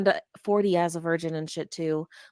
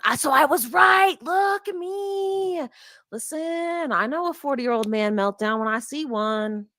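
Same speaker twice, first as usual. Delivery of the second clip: mock smug man; 7 semitones higher than usual. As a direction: play a know-it-all man, stretched out. Voicing John in the video.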